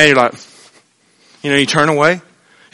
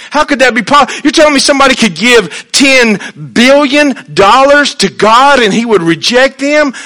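normal talking voice

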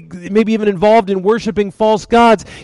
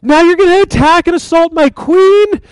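high, loud outraged king; indignant outburst, voice pitched way up; freaking out; 11 semitones higher, 4 dB louder than usual. Acted. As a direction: act out a furious, incredulous husband. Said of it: "and he freaks out".

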